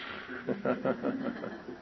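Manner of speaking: quiet, voiceless run of laughter; real soft chuckle. Real, not acted. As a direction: chuckle softly to yourself. Chuckles before closing the meeting.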